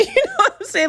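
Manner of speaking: high-pitched words through a laugh. Real, not acted. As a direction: giggle through the aside. Laughs while making her point.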